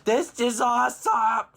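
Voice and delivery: high, loud, slow thrilled cry; excited worm. Acted. Worm discovering apple, high thrilled cry. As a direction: gush with delight. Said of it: "He's like".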